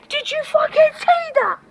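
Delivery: very high excited squeal; amazed excitement. Real, not acted. Asking a mate about the firework, amazed excitement.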